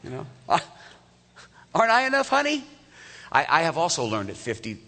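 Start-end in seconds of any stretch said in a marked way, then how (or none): 0.5-2.6 much higher, louder, wheedling husband voice; hammed-up sweet-talking spouse; mock husband. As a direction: send up a husband fishing for reassurance. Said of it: "You ever find yourself saying that".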